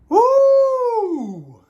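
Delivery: long, high, loud whoop; piercing celebratory whoop; excited woo. Real, not acted. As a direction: let out a drawn-out "woo". Reacting excitedly to the reveal.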